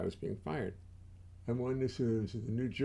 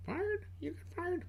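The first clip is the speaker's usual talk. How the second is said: much higher squeaky voice, mock disbelief; 6 dB quieter than usual. Squeaky voice asking himself about being fired. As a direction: play a shocked little voice. Mock dialogue after reading the plaque.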